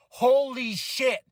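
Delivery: slow drawn-out holy shit; stunned delight; amazed. Retold or imitated. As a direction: savor the surprise. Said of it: "I was like".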